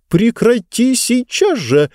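high, slow nervous squeal; нервный взвизг. Acted. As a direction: shriek it out nervously. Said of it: "нервно взвизгнула книга". (нервный визг, высоко и медленно)

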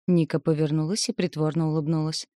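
ordinary delivery, nothing marked

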